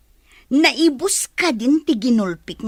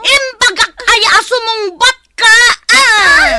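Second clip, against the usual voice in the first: high, loud, raging shout; furious scolding; 12 semitones higher, 9 dB louder than usual. Acted. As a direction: scream the scolding at the disobedient child. Aunt scolds and hits the children.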